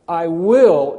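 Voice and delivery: "will" hit hard and loud as a declaration; emphatic stress on WILL. Shown as a demonstration. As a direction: punch the word "will". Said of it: "is emphatic".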